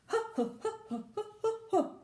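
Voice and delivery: high playful ho ho; bouncy high hoots; high ho ho chant. Acted. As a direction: chant it gleefully. Recap of noises.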